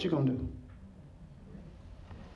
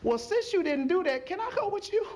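a hint of a pleading bystander, pitched up; begging onlooker; 10 semitones higher than usual. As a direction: plead lightly to be let in. Onlooker begging to join Noah.

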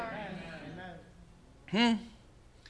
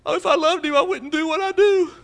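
much higher whiny voice; mock self-pity; 10 semitones higher than usual. Preacher voicing a believer agreeing with the devil, high mock whine. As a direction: whimper it high in hammed-up defeat.

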